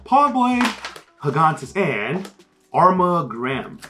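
ordinary talk

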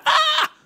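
sudden high yelp of alarm; startled shriek. Retold or imitated. Preacher recalling stressful week, high startled yelp. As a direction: squeal as everything hits at once. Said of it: "and I went".

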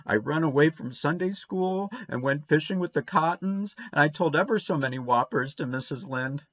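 put-on high, eager child voice tumbling out misdeeds; confessing little boy. A narrator's reading. Davy confessing all his misdeeds to Anne, high and anxious. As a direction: blurt out every sin, slowing on the last.

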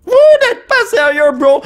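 high, drawn-out incredulous yell; shocked disbelief. Real, not acted. Collector suspecting a hidden Rainbow Pikachu, shocked and yelling. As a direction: cry it out slowly in disbelief. Possible rainbow card in pack.

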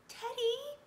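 high, loud call to the dog; excited cry. A narrator's reading. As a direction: cry out the name. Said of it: "cried Annie".

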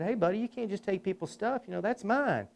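lightly voiced gentle scolding of a kid; gentle grown-up to little kid. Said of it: "like I'm gonna give him a life lesson".

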